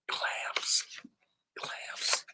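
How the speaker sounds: hushed, breathy seagull calls; whispered seagulls. Acted. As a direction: whisper it like greedy gulls. Voicing seagulls.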